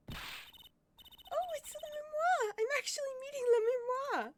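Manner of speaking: very high, breathless-with-joy fangirl; starstruck squeal. Acted. Girl meeting her favourite singer, starstruck squeal. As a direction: squeal like a thrilled fan.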